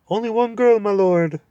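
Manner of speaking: high, loud owlish hoot of a voice. Acted. Owl hooting up at the deaf dwarf.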